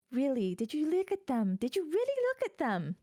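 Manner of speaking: outraged that anyone would pick them; incredulous. Acted. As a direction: mage ranting to her cat about her companions. Mage complaining to her cat.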